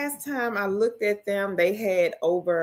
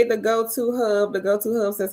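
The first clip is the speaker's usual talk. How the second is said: ordinary talk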